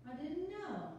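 quick, bubbly, a little flustered; nervous newcomer rattling on; nervous chatty woman. Acted. [name] reading her part.

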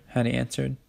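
plain reading voice

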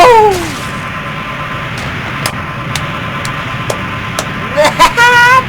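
shrieking burst of laughter; loud high laugh. Real, not acted. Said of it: "ตลกใส่เสียง".

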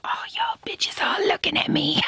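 high, whispered sass; sassy whisper. Pretend. Daughter whispering a sassy line at the camera.

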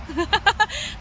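high giggle, rising at the end; real high chuckle; amused laugh. Real, not acted. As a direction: giggle at your own naughty hint. Laughs after the punchline hint.

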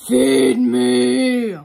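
loud, booming truck character; garbage truck voice. Acted. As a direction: boom it like a hungry truck. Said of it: "garbage truck voice".

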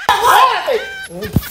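muy agudo y alargado; súplica alarmada. Real, not acted. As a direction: alarga la palabra para frenar al otro. Intenta frenar al otro. (grito agudo y arrastrado)

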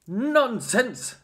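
loud high indignant shout. A narrator's reading. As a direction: shout it as an outraged prince. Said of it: "shouted the prince".

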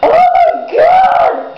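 very high, slow, drawn-out scream of joy; excited shriek. Retold or imitated. Vlogger retelling hearing a radio ticket win, excited shriek. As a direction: squeal like you just won. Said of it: "And I was like".

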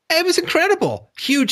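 high-pitched, incredulous marvelling; amazed. Real, not acted. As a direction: marvel at it, pitch leaping up then easing down. Marvelling at past boom.

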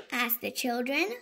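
ordinary delivery

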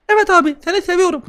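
a tiny brat swearing; squeaky baby voice. Pretend. Gamer voices the demon baby cursing him, squeaky.

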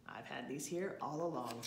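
low-pitched bear voice; deep bear revealing a gift; bear husband voice. A narrator's reading. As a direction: drop low for the big bear. Mister Bear revealing his gift.

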